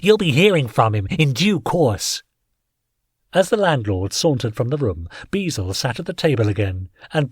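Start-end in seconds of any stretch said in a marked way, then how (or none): 0.0-2.2 smug threat. A narrator's reading. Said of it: "He gave a chuckle".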